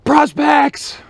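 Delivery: high, loud bellow across the lot; yelled order. Retold or imitated. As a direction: bark the call for the prospects like a sergeant. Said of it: "puts his head down like this and yells".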